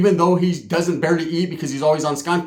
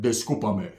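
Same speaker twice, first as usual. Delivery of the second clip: low muttered; 10 semitones lower, 8 dB quieter than usual. Retold or imitated. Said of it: "I'm like".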